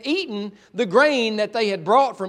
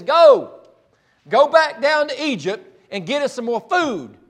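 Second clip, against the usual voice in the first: urgent command; 5 semitones higher, 6 dB louder, slower than usual. Acted. Jacob orders sons to Egypt.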